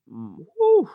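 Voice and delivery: high, loud shudder of a whew; shivery cold whew. Retold or imitated. Recalling the freezing beach shoot, with a high shivering whew. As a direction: shiver out a breath.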